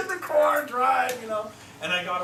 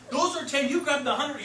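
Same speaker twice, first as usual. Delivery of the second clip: raised, louder mock indignation; insistent scolding; 6 semitones higher, 5 dB louder than usual. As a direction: press the accusation, pitched up. Retold scolding of thieving roommate.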